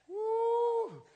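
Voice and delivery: high held woo; joyful whoop. Real, not acted. Preacher whooping at the congregation, high. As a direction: whoop with delight.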